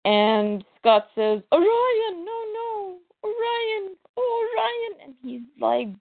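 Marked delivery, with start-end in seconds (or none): 1.5-5.0 high, wailing grief over a body; hammed-up sobbing for a fallen brother; overdone lament; mock crying. Scott finds Orion in blood.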